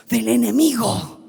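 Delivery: ordinary talk, nothing marked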